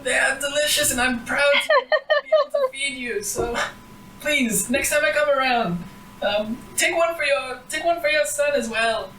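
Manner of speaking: put-on high, wavering old granny voice; a sweet, quavering old woman. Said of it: "the granny goes".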